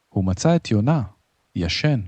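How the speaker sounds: ordinary delivery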